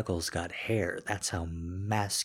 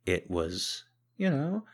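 ordinary delivery

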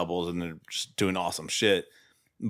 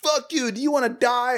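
very high mock yell; hammed-up angry screech at modest volume; retold scream; 14 semitones higher than usual. Retelling the cop snapping back at Utah, high mock scream. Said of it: "he just screams in his face".

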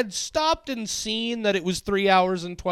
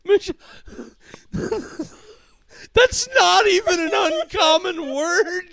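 high, laugh-choked protest; real helpless laughing voice, pitched way up; crying with laughter; 11 semitones higher than usual. Real, not acted. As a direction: wail it in disbelief through laughter. Helpless laughing fit over mispronunciation.

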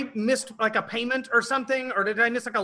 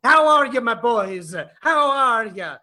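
louder hammy Italian papa greeting; fake Italian accent; 4 dB louder than usual. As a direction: gush over the sons like a cartoon Italian father. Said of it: "this fakey Italian accent".

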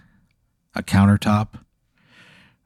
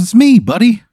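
much higher, louder, friendly creature voice; cheery snooze monster; 11 semitones higher, 6 dB louder than usual. Acted. Snooze monster greeting Pat with a high, chummy voice. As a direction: greet him like an old buddy, high and bright.

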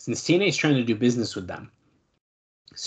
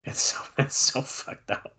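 laughing; 2 semitones higher, 3 dB quieter, slower than usual. Real, not acted. Laughing at absurd rumor.

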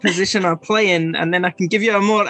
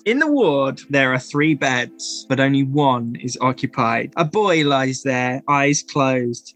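game master narration; 4 semitones lower than usual. A narrator's reading. GM describes the sleeping boy in the ward, low narration.

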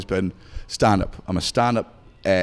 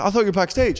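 put-on puzzled girlfriend; 10 semitones higher, faster than usual. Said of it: "She's like".

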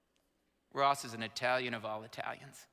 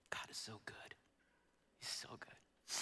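soft reverent whisper; whispered praise; 4 semitones lower, 10 dB quieter than usual. Real, not acted. Pastor thanking God to the congregation, in a whisper. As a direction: breathe it out like a private prayer. Quiet praise after painful memory.

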